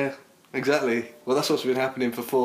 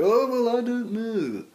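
exaggerated posh mimicry, high; 12 semitones higher, slower than usual. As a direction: ham up a plummy dodge. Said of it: "in a more posh voice".

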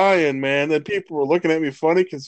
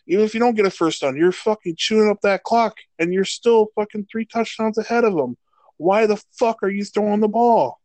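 heated rant; 8 semitones higher than usual. Real, not acted. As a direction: vent at the coaches, pitch up. Ranting about Falcons play calls.

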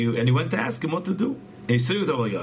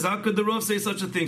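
higher, pleading question without real tears; retold tearful protest; 7 semitones higher than usual. Wife protesting the ruling to the rabbi, mock tearful. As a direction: lightly act out a distressed plea. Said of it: "She cries".

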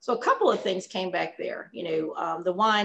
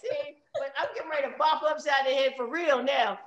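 higher, laughing playful threat; laughing mock threat; 5 semitones higher, 3 dB quieter than usual. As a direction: threaten in jest while giggling.

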